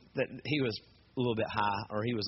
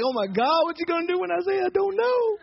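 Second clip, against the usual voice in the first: very high, squealed dread; mock panic; 4 dB louder than usual. Trapped teller who cannot read the tattoo, panicked. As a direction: let the voice climb into a helpless squeal.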